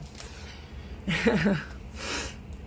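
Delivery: short amused chuckle to yourself. Real, not acted. Laughing after mock villain line.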